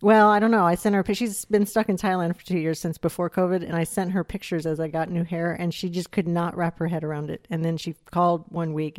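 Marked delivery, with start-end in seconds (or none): none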